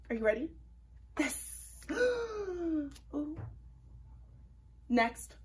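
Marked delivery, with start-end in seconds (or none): 1.1-1.9 hushed whispered this; whispered reveal. Acted. Revealing the germ. 1.9-2.9 high mock-scared ooh; gasp and ooh. Germ reveal.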